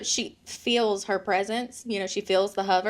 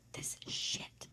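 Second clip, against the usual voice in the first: barely audible whispered cussing; imitated furtive whisper; 19 dB quieter, slower than usual. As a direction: whisper it secretly, like a kid cussing under her breath. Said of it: "Oh, she whispers it".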